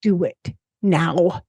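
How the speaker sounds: slow, deliberate, every phrase weighted; strong, emphatic message. Acted. Host delivering the card's message to listeners, slow and firm. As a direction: lay it down firmly, phrase by phrase. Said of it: "How's that for pretty strong?".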